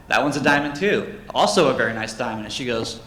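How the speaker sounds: usual speaking voice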